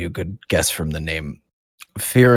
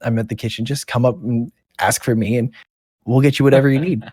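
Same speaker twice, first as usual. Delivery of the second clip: eager Bill; 5 semitones higher than usual. Acted. Bill introducing himself to the party, higher and eager. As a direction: come on keen and helpful. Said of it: "goes to you guys and goes".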